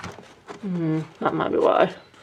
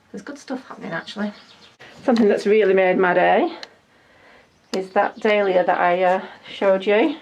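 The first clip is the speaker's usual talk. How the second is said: usual speaking voice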